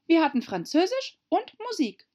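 put-on very high girlish voice; young Alice answering. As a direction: pipe up in a high voice. (hohe, helle Alice-Stimme)

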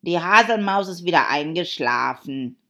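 loud Hatter voice; Hatter, announcing. Acted. Hatter noticing the sleeping dormouse, loud and emphatic.

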